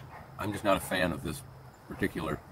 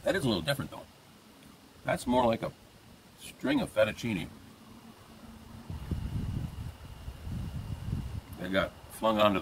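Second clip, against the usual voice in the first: words slightly muffled by food; talking while chewing; 3 semitones higher than usual. Real, not acted. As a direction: mouth partly full. Seen 2: bit food near -9s, jaw working while talking.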